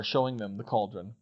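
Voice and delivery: usual speaking voice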